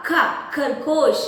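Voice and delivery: ഖ in ഖ, ഖർഗോഷ് as aspirated kh, not ga, said slowly; aspirated kha. Shown as a demonstration. Teacher corrects students who say ഗ, slow aspirated ഖ. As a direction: slowly breathe out the kh of ഖ. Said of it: "ഖായിനെ ഗായിനെ pronounce ചെയ്യുന്ന".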